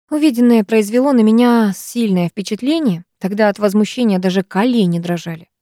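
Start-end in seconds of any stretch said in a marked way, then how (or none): none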